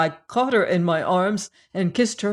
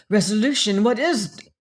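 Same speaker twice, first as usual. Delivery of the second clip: breathless question; 3 semitones higher, 5 dB louder than usual. A narrator's reading. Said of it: "she questioned breathlessly".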